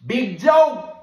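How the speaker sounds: high loud call of the name; officer hollering at the dad; hollered name. Retold or imitated. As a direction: holler it down the hall. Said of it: "Get what he holler".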